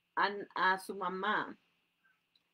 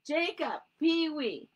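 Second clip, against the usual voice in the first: mother calling, higher and louder; 7 semitones higher, 6 dB louder, slower than usual. Acted. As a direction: call the kids from the window. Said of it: "llamó mamá desde la ventana".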